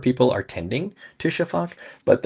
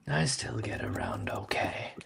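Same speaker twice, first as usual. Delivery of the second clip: dragon voice; 11 semitones lower, 10 dB quieter than usual. Acted. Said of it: "I still like the voice".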